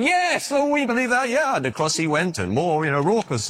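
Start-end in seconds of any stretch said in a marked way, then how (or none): 0.0-1.6 a high, mock cheering crowd. A narrator's reading. Crowd at Niagara Falls cheers the tightrope walker, high mock cheer. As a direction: cheer him on eagerly. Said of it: "they shouted".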